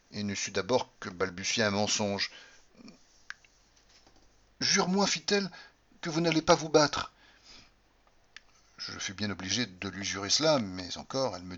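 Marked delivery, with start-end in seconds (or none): 4.6-7.1 high, pleading demand; urgent plea. Acted. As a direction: beg him not to fight. Cordelia supplie, pâle. (supplication pressante, plus aiguë)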